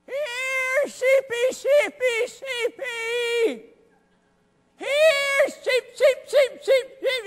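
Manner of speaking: high, loud sing-out to the flock; calling sheep. Acted. Shepherd calls his lost sheep up the mountain, high holler.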